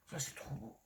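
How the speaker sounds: admiring whisper. Real, not acted. Vlogger whispers admiration for the paper. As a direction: breathe it out in awe.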